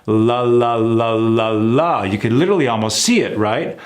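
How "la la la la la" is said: la la la with the tongue brought forward to the teeth; exaggerated forward L drill. Shown as a demonstration. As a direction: sing out clear, forward la sounds. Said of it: "Bring the f-, tongue forward".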